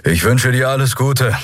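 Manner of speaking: straining over engines. A narrator's reading. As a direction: call out over engine noise. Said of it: "Thelios Stimme kämpfte gegen die Antriebe an".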